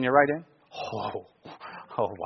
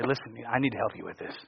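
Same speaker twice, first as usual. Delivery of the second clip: soft, lowered, gentle appeal; compassionate; 6 semitones lower, 15 dB quieter than usual. Acted. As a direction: drop low and quiet, offering help kindly. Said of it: "approach it with compassion".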